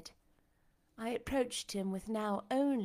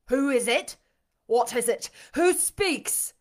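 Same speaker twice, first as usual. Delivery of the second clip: agitated demand; 2 semitones higher, 10 dB louder than usual. A narrator's reading. Blind master startled by a voice, loudly demanding. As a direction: fire out each question, louder than talk.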